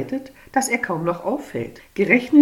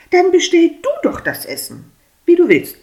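high, loud, huffy; wife loses patience, much higher and louder; fed-up snap; 12 semitones higher, 7 dB louder than usual. Acted. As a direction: throw the task back at him. Wife snaps at husband.

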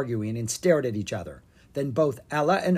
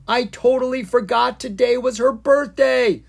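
panicked; 11 semitones higher, 8 dB louder than usual. Acted. Both panic, realise they forgot.

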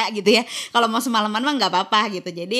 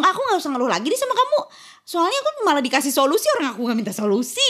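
higher-pitched, quickened grumble; retold complaint; 8 semitones higher than usual. Wife tired of getting solutions instead of listening, retold complaint. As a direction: replay a huffy complaint to your partner. Replaying her complaint to partner.

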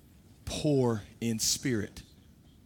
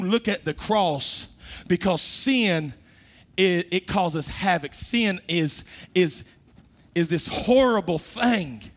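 louder preaching, slowing on the cross; impassioned preaching; 6 semitones higher, 5 dB louder than usual. Acted. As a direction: lean into each word about sin. Preaching on sin, slower.

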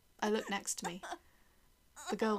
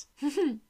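put-on childish giggle; 5 semitones higher, 7 dB louder than usual. A narrator's reading. Girl laughs.

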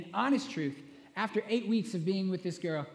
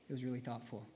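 soft and low, a lightly voiced girlfriend; a hint of polite indifference; mild polite brush-off; 8 semitones lower, 12 dB quieter, faster than usual. Girlfriend answers about letter.

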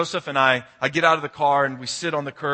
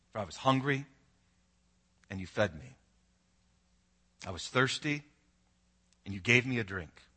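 soft, measured scripture recital; scripture reading; 3 semitones lower, 6 dB quieter than usual. Acted. Pastor reciting Matthew to the church, soft and measured. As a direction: read the verse gently, pausing between lines.

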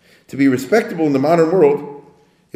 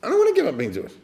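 voiced much higher, a pleading insistence; insistent traditionalist; 11 semitones higher, faster than usual. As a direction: speak up as a stubborn traditionalist who won't let go. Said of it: "these guys represent the ones who say".

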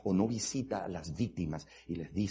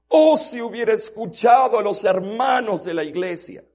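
retold wail; 12 semitones higher, 14 dB louder than usual. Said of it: "la tercera".

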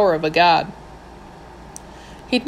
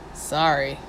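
croaking; 5 semitones lower, 4 dB quieter than usual. Acted. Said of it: "he croaked".

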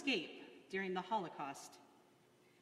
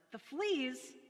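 incredulous; 5 semitones higher than usual. A narrator's reading. Corrie shocked at thanking for fleas.

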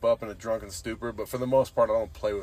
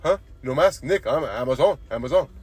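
loud, nagging mock-accent questioning; hammed-up insistent coworker impression; imitated Mexican coworker; 4 semitones higher, 5 dB louder than usual. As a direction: pester a buddy in short loud bursts. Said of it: "He keeps asking me".

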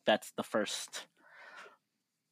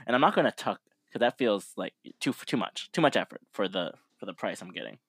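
usual speaking voice

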